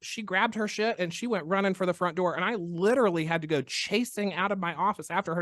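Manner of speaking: normal talking voice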